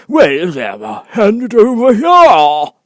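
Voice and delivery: high, loud, important bird voice; grand old bird holding forth; pompous Dodo. Acted. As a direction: puff up like a self-important Dodo.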